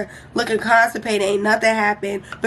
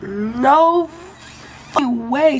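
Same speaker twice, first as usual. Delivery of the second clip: stunned disbelief; much slower than usual. Retold or imitated. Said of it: "I'm like".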